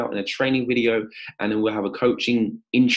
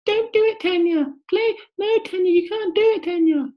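squeaky, very high, louder voice; nagging inner voice; 4 dB louder than usual. Acted. Coach voicing Tanya's inner doubt as a squeaky, very high voice. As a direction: play the little doubting voice in her head. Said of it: "that little voice in your head".

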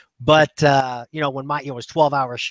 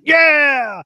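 cheering at a try; 10 semitones higher, 5 dB louder than usual. Retold or imitated. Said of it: "I was like".

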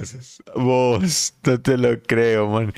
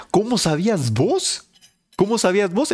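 teasing high question; 9 semitones higher than usual. Acted. Reclamo burlón al compañero.

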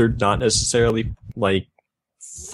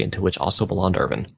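ordinary talk

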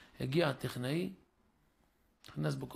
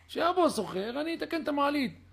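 higher, sarcastic retort; mock retort; 9 semitones higher, 3 dB louder than usual. Avrech scoffs at the neighbour's demand, high and sarcastic. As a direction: throw it back with a high mocking lilt.

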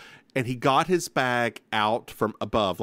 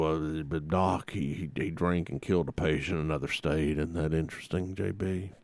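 lowered character voice; 8 semitones lower, 5 dB quieter than usual. Pretend. Continues the low character.

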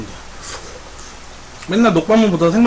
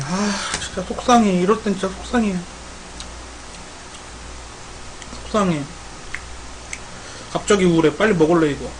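mock sulk; 7 dB quieter than usual. Mock sulking over food.

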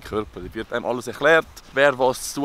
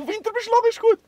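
begeistert; 4 dB louder than usual. Real, not acted. Wortspiel gelingt.

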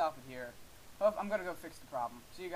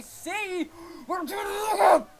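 high, loud, building to a scream; real yelling meltdown; frustrated scream; 13 semitones higher, 14 dB louder, slower than usual. Real, not acted. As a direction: lose it at the game. Screams at the game.

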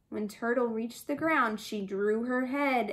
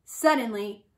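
ordinary delivery, nothing marked

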